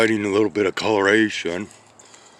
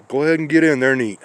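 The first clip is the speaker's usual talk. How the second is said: higher, louder, fast shooing; urging a cat; 5 semitones higher, 5 dB louder, faster than usual. Real, not acted. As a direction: hurry the cat along to its food.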